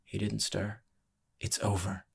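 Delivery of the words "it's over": soft, firm insistence; quiet insistence. A narrator's reading. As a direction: insist under your voice, softly. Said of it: "Lee insisted".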